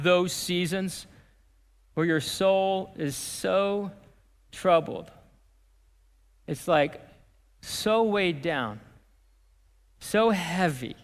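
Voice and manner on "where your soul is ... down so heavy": slow, heavy, with long pauses; drawn-out, subdued empathy; weighed down. Acted. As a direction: let each phrase sink under its weight. Describing a heavily troubled soul.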